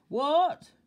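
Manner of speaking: loud, high bark of a question; booming shopkeeper's "what?"; shouted query. A narrator's reading. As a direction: holler it, unable to hear. Grocer cannot hear him.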